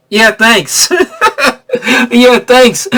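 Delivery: awkward laughing thanks. Retold or imitated. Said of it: "they're just like".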